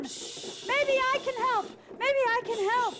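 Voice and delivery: high, loud, calling out; shout over wind. Acted. Child calling to the crew over the storm, shout over wind. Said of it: "I shouted over the wind".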